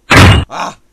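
short loud cry of frustration; frustrated yell. Real, not acted. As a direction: blurt out a sharp angry shout. Frustrated outburst at game.

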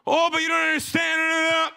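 high, drawn-out mock protest; acted complainer. Panicked believer objecting to God's word, mock wailing protest. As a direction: wail it like an exaggerated objection. Voices a frantic congregant objecting.

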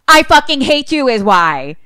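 acted angry outburst; angry retort. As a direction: throw it back furiously in a quarrel. Acted lovers' quarrel in retelling.

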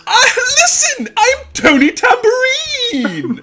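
high-pitched theatrical self-announcement; showman. Acted. Shopkeeper defends his flamboyant greeting, high showman's flourish. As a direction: ring out the name high and long. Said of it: "there's a bit of showmanship".

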